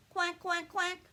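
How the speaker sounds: high, loud duck quacks; quacking duck. Acted. Little Duck greeting each guest at the door, high loud quacks.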